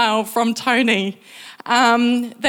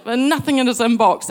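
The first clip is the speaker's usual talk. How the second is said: ordinary talk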